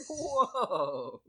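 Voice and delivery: high, loud startled whoa. Retold or imitated. Parent reacting to daughter's shout, high and loud. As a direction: pull back, amazed. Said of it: "I was like".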